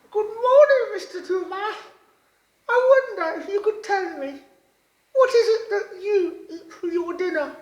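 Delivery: high, loud, bright-eyed little frog voice; wide-mouthed frog voice. A narrator's reading. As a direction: squeak it out like an eager little frog. Frog greets the giraffe.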